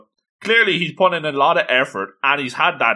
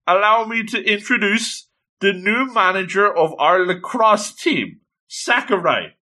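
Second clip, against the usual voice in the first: announcer-like introduction; 7 semitones higher than usual. Acted. Girl introducing the new manager to her team, grandly announced. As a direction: present the newcomer grandly, pitched high.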